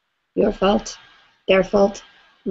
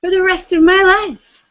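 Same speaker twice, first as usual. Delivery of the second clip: defiant, higher and louder declaration; with attitude; 9 semitones higher, 6 dB louder than usual. Shown as a demonstration. Tapping leader demos attitude for the group. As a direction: throw it out with sass. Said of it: "Say it with some attitude".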